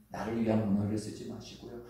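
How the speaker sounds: normal talking voice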